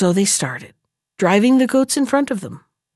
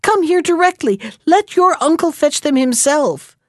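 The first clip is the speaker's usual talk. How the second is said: put-on mother calling a child back, higher-pitched; calling after him; 6 semitones higher than usual. A narrator's reading. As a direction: raise the pitch and summon him sternly. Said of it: "his mother called after him".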